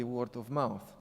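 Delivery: usual speaking voice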